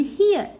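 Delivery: हियर with a short इ. Shown as a demonstration. Teacher giving an example to learners, हियर with short इ. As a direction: say हियर, keeping its first vowel short. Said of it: "छोटी ई के रूप में".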